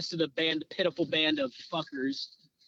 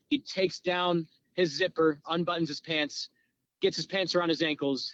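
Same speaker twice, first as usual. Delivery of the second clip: normal talking voice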